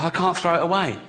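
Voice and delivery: ordinary delivery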